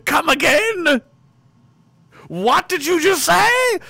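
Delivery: bewildered time traveller hearing the news, high and incredulous; mock disbelief. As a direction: play someone who can't believe the headline. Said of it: "I would say".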